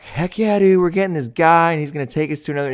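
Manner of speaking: high, fast, eager player voice; mock hyped player. Players cheering Deion's arrival, high and eager. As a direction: pump it up like a thrilled teen. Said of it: "And they're like".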